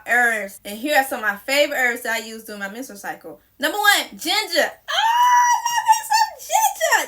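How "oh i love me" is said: very high, slow, laughing squeal; squealing delight. Real, not acted. Telling viewers about her favorite herb, squealing with laughing delight. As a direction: gush over ginger like a crush.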